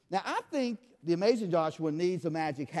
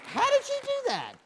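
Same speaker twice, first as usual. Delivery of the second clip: mock amazement.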